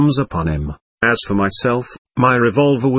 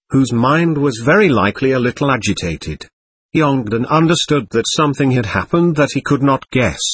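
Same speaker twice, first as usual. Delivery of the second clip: plain reading voice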